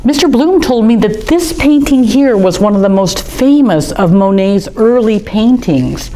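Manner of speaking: ordinary delivery, nothing marked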